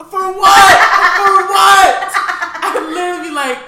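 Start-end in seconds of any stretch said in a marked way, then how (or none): 0.1-2.1 high, loud outraged yell; laughing, disbelieving yell at dad; incredulous shout. Retold or imitated. Retold outrage at father boxing scraps.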